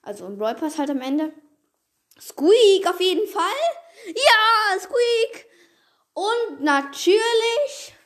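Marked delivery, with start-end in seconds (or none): 2.2-5.5 high squealing excitement; shrieking jackpot winner. Real, not acted. Hyped about new brawler. 6.1-7.9 drawn-out excited. Real, not acted. Drawn out hype.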